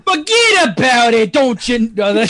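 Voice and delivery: loud-mouthed mock New Yorker; Brooklyn accent. Shown as a demonstration. Performer taking the Brooklyn request, hammed-up accent. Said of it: "New York accent. Brooklyn accent".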